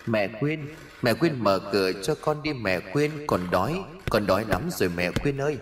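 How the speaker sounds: put-on soft, plaintive little-girl voice; ghost child Na. Na begs Quyên to open the door, softly pleading. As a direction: quietly beg like a hungry ghost child. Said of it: "kèm theo là giọng nói của bé Na".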